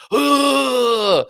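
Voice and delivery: high straining grunt like a Spartan warrior; mock battle grunt. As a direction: grunt as if punching yourself. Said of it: "And like".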